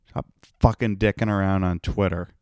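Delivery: normal talking voice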